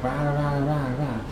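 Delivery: babbled imitation of gibberish; mock nonsense talk. Imitating the man on a bike, mock nonsense babble. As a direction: lightly imitate someone rattling off nonsense. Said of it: "just talking nonsense".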